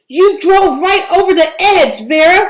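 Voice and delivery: high, loud angry accusation; loud high furious blame; accusing. A narrator's reading. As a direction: blame the driver loudly. Accusing after crash.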